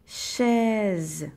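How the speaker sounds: "chaise" stretched out, each sound held; sound-by-sound word. Shown as a demonstration. Teacher giving pupils a word to split, elongated "chaise". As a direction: draw out ch, vowel and final z in "chaise". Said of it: "le premier mot c'est".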